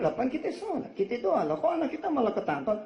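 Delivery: high, baffled complaint; bewildered protest. Retold or imitated. Parents confused that their son was arrested, high and baffled. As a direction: sound puzzled, up high.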